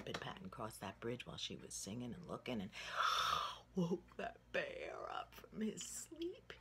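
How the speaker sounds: plain reading voice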